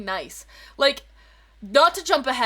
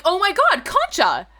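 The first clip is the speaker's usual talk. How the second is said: excited recognition; 8 semitones higher, 4 dB louder, slower than usual. Retold or imitated. Recognizing friend, excited greeting.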